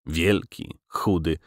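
usual speaking voice

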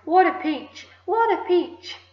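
high, swooping exclamations; excited shout. A narrator's reading. Aunt marvelling at the giant peach, excited shout. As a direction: crow over it with delight. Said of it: "Aunt Spiker shouted".

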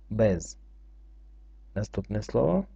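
normal talking voice

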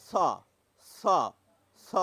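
dental স as a clean s; dental sound demo. Shown as a demonstration. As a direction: say dental স three times. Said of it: "বরাদ্দ ধ্বনি হচ্ছে".

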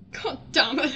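loud exasperated swear; frustrated curse. Real, not acted. Annoyed player cursing, loud. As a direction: curse the game.

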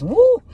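high, loud "woo"; sudden high-pitched cheer; excited whoop. Real, not acted. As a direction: let out a piercing whoop. Whoops over the card reading.

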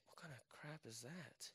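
very soft, muttered aside; retold reaction slipped out under the breath. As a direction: mutter it almost inaudibly to yourself. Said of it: "underneath my breath".

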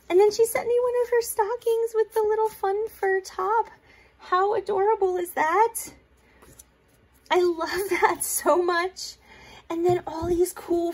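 high, bright-eyed gushing over a gift; excited gushing. Real, not acted. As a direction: gush like you just opened a present. Gushing over gifted craft supplies.

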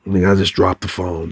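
normal talking voice

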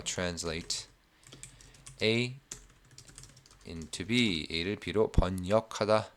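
slowly, softly spelling it out to oneself; low-key, word by word with long gaps; slow dictation. Real, not acted. Teacher noting a phrase for students, slow and quiet. Writing out the phrase just explained.

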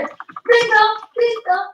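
high, loud shout; sharp excited yell; calling out. Real, not acted. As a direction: call it out to the team. Calling out in battle.

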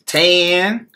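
mock the pupil's answer, drawn out and high; mocking teacher. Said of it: "he mocked me".

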